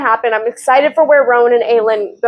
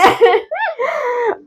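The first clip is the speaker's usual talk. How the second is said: laugh; 11 semitones higher, 3 dB quieter than usual. Real, not acted. Recalling Rowan's return.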